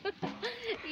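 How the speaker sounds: soft, high chuckle; a quiet laugh at the letter; amused laugh. Real, not acted. (ହାଲୁକା ହସ)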